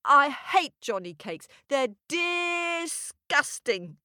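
high, slow mock disgust; boy's fake disgust. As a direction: drag out disgusting like a fussy kid. Jack voice.

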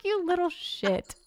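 mother fondly scolding her prankster son, slow and high; affectionate scold. Retold or imitated. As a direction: tease him like a loving mom. Mom's fond retort to joking son.

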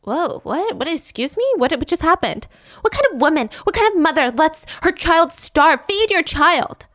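mock indignant lecture; outraged stranger. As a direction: demand she feed her child. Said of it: "She's like".

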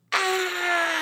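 short high mock scream; imitated scream. Two girls on the ottoman startled: high mock scream.

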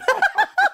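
high squealing laugh; a real laugh that shoots way up; burst of laughter. Real, not acted. Laughing at the bar story.

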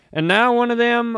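usual speaking voice

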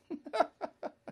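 short high incredulous laugh; high scoffing laugh. Real, not acted. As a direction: laugh out in disbelief. Laugh after the quote.

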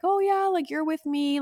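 put-on high, sweet welcome to a child; high-pitched mock friendly greeting; super nice counselor. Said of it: "like, super nice".

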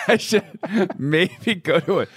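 words broken up by high laughter; laughing. Real, not acted. Patient cracking up at friend's pill joke, laughing. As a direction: try to talk while cracking up, pitch shooting up.

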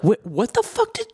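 high, shocked, stammered what the fuck; stunned disbelief. Retold or imitated. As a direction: mimic a friend's jaw-drop reaction, climbing to a squeak. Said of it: "'Cause you were like".

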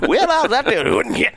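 high, loud, drawn-out cartoon excitement; overexcited old Disney character spluttering into a cough; excited fifties Disney character. Host mocking his own coughing fit, excited fifties Disney character. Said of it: "a character in a Disney movie from the fifties".

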